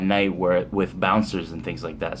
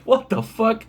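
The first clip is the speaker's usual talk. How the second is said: high-pitched, incredulous mock reaction; baffled and tickled, pitched very high; amused disbelief; 13 semitones higher than usual. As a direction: retell your shock, voice shooting way up with amusement. Retold disbelief, laughing as he tells it.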